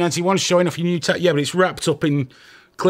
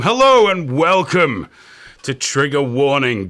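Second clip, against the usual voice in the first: announcer voice; 5 dB louder than usual. Acted. Show host opening.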